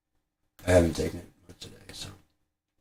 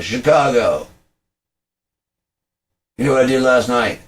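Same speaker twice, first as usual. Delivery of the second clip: loud, raised stage greeting; hailing the crowd; 6 semitones higher, 6 dB louder than usual. Acted. Comic rolling into Chicago greets the crowd, hailing loudly. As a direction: call out to a big crowd.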